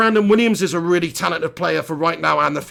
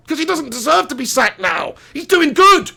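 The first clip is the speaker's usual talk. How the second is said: shouting; 7 semitones higher than usual. Real, not acted. Heated football opinion rant.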